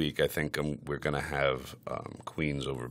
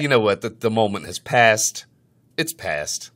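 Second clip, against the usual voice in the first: loud, raised-pitch dismissal; mock-offended; huffy exasperation; 6 semitones higher, 10 dB louder than usual. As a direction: give up on it in a loud huff. Gives up on the bit, huffy.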